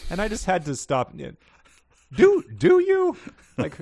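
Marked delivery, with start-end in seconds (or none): 2.2-3.2 loud, very high, drawn-out squeak; mock disbelief. Reader interrupts the book's claim, squeaky mock disbelief. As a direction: squeal the doubt slowly and loudly.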